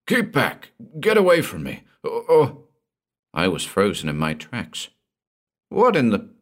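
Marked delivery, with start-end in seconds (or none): 0.1-2.6 high, agitated cry of alarm. A narrator's reading. Gordon recoils from the dagger with clenched fists, crying out. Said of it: "he faced me, crying". 5.7-6.3 high-pitched, baffled start, cut off; bewildered. A narrator's reading. Narrator begins a question to frantic Gordon, bewildered. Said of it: "I began bewilderedly".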